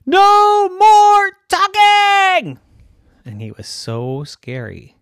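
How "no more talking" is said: high, drawn-out furious yell; angry shout. Acted. Fed-up brother shouting at his chattering sister, angry shout. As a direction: shout it slowly at the top of your voice. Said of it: "he got so mad, he shouted".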